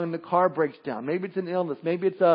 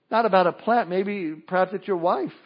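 normal talking voice

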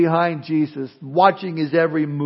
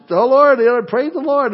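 high, cooing mock baby voice; baby talk; 7 semitones higher, 4 dB louder than usual. Grandfather voicing his baby granddaughter, high baby talk. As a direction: babble it like a six-month-old. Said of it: "in baby talk".

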